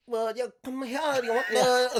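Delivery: high, loud, slow mock Vietnamese accent; Vietnamese vendor accent. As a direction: call a customer over in a hammed-up Vietnamese accent. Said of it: "and they're like".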